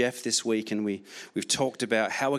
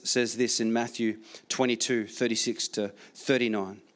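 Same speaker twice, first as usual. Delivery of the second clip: normal talking voice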